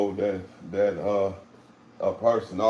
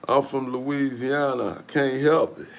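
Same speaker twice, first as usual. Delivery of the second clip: ordinary delivery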